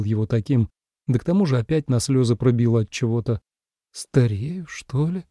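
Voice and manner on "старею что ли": displeased thought. A narrator's reading. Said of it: "с неудовольствием подумала Су Илань".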